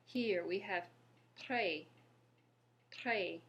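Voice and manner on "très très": "très" with French R and open eh; careful French pronunciation. Shown as a demonstration. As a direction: model "très" as one crisp word, silent s. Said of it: "Let's look at some examples".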